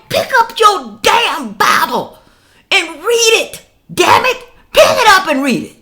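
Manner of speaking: high, loud, exasperated scolding; furious rant at the viewers; angry preaching. Real, not acted. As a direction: shout it at them like a street preacher. Ranting at viewers about the Bible.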